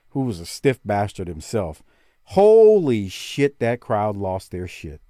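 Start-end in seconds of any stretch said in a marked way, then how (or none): 2.3-3.6 excited. Retold or imitated. Crowd erupting over surprise kick.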